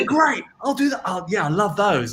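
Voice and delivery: high, bright put-on voice of eager agreement; mock-eager customer. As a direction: gush like a thrilled shopper. Mock-eager reply to shop staff.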